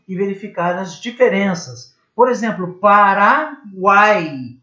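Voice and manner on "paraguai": Paraguai stretched slowly, uai as one syllable; drawn out. Shown as a demonstration. Teacher sounds out a triphthong example. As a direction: glide through uai in Paraguai. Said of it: "Numa mesma sílaba".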